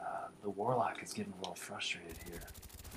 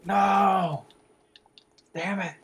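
high, loud drawn-out cry of loss; despairing shout; 8 semitones higher, 10 dB louder, much slower than usual. Real, not acted. Gamer shouts as he loses the fight, loud and high. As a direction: scream no as you die.

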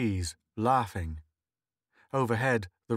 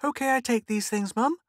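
put-on excited little girl; 13 semitones higher, 3 dB louder than usual. Little girl voiced in story.

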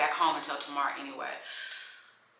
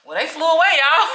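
higher, louder burst of disbelief; exclaiming; 10 semitones higher, 13 dB louder, faster than usual. Real, not acted. To viewers as the birds flee her camera, a high loud exclaim. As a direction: cry out as the birds vanish.

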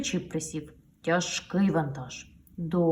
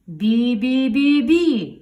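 slow loud horn beeps, higher; loud honking; 6 semitones higher, 11 dB louder, much slower than usual. Acted. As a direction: honk with all your might. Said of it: "щосили сигналить ваговіз". (гучне бібікання)

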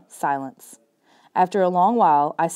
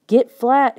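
loud urgent command, a bit higher; forceful loud order; sharp order; 6 semitones higher, 7 dB louder than usual. A narrator's reading. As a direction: bark the order to get down. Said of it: "he ordered".

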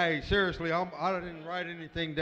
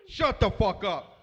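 shouted shut up; 3 semitones higher, 11 dB louder, slower than usual. Real, not acted. Roaster yelling at a heckler, loud and slow. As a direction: yell it down at the heckler.

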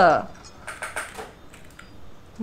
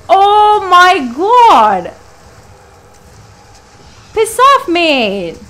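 higher, louder cry; startled yell; 7 semitones higher, 6 dB louder, much slower than usual. Real, not acted. Frightened outburst. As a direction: player jumps and yells at the monster.

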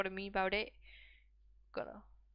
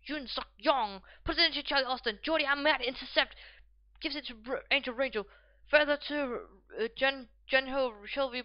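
raised, loud running match call; play-by-play commentary; 4 semitones higher, 8 dB louder than usual. Acted. Gamer commentating his match pass by pass, urgent play-by-play.

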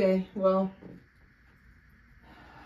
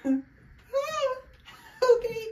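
sharp high cry of pain; 3 dB louder, much slower than usual. Real, not acted. Pain at level five.

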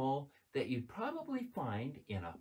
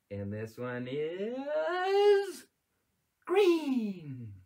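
suspenseful reveal; 7 semitones higher, 5 dB louder, much slower than usual. Acted. Slow build to reveal.

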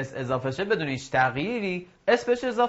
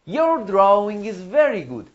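very slow, louder and higher, every word clear; slow model for repeating; 5 semitones higher, 3 dB louder, much slower than usual. Shown as a demonstration. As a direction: read it slowly for students to echo. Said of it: "تکرار کنید بعد من". (جملهٔ نمونه، آهسته و شمرده)

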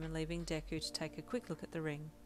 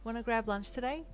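bright, higher, louder invite; cheerful grin; 6 semitones higher, 5 dB louder than usual. A narrator's reading. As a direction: ask with a big grin. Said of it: "with a giant grin on your face".

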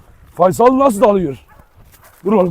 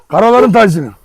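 normal talking voice

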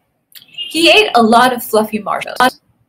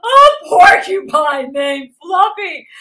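howling with laughter; 7 semitones higher than usual. A narrator's reading. Rhinoceros mocking Fluffy's name, howling with laughter. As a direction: howl it out, then guffaw. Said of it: "howled the rhinoceros".

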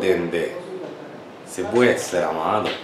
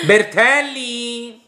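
character call; much slower than usual. Retold or imitated. Calling out a TV character.

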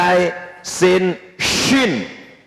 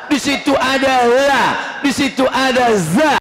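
higher and raised, scornful listing; mocking mispronunciation; 6 semitones higher than usual. Preacher mocking sloppy Quran reciters, raised and scornful. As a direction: call out each botched letter.

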